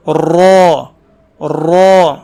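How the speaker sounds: را full-mouthed with a trilled ر; rolled heavy ra. Shown as a demonstration. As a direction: roll the ر of را and fill the mouth. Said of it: "منہ بھر کے اس کی آواز نکلتی ہے". (منہ بھر کے لرزتی را)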